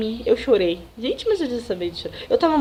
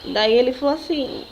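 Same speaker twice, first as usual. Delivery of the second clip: ordinary talk, nothing marked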